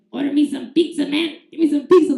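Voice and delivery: imitated drunken pestering; drunk uncle. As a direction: badger them like a tipsy uncle. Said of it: "I always heard his drunk voice".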